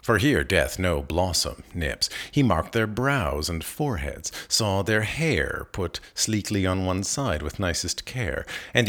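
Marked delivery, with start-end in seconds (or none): none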